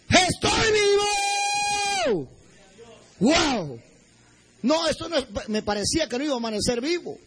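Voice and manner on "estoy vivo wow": joyful shout. Retold or imitated. Survivor at daybreak finding himself alive, joyful shout. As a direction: shout it out, stretching the last vowel. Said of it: "pegué un grito allí".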